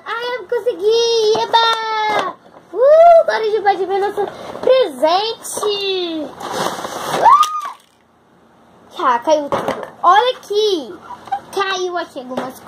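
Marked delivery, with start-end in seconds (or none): 0.0-7.4 excited cheer. Real, not acted. Child finally opens the box.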